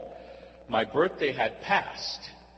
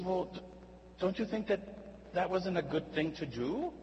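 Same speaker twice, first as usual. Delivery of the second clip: higher, softer probing question; probing friend; 5 semitones higher, 6 dB quieter than usual. Retold or imitated. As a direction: coax a confession gently.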